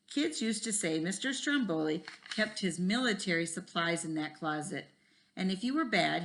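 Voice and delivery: put-on reading a chapter book aloud to kids; lively read-aloud with voices for each kid; story reading. A narrator's reading. Teacher reads a school mystery to the class, lively.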